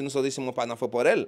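ordinary delivery

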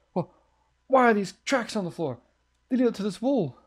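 rattled by tracks leading to the wall; real nervous alarm; spooked. Real, not acted. Scared by tracks.

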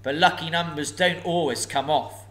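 a huge dragon toying with a thief; booming sly dragon; menacing dragon. Acted. Dragon speaks.

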